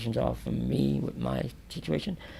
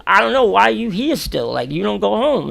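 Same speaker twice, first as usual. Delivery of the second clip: raised, high imitated voice; calling back; 8 semitones higher, 10 dB louder than usual. Man across asks why he is still here, high loud calling. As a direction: throw it back loudly across the cells. Retold loud exchange between facing cells.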